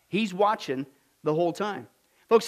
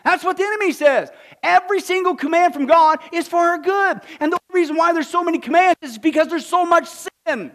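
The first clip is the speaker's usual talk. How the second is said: loud, high fiery sermon; preaching at full force; 10 semitones higher, 12 dB louder than usual. Acted. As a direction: drive it home to the congregation.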